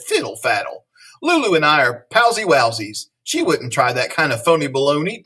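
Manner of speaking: lively read-aloud with playful bounce on silly words; animated picture-book reading; story narration. A narrator's reading. Reading a silly school story to children, animated storyteller.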